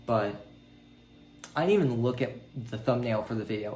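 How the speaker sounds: ordinary talk, nothing marked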